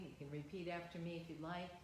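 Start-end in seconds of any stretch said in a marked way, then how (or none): 1.0-1.7 slow, measured prayer with long pauses. Real, not acted. Minister leading congregation in prayer of protection, slow prayer. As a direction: say it slowly, phrase by phrase, for others to repeat. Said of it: "I'll say it slowly".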